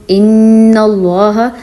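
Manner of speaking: ان اللہ with the nun held and nasalised; nasal ghunna. Shown as a demonstration. Teacher demonstrating ghunna in ان اللہ to students. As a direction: hold the ن of ان اللہ through the nose. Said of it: "اس کو میں ناک میں آواز نکال لی ہے".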